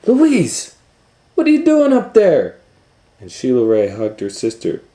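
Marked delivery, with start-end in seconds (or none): 0.1-2.6 very high, loud astonished cry; surprised. Acted. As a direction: gasp it out in disbelief. Astonished at finding sister.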